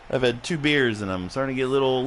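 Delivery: ordinary talk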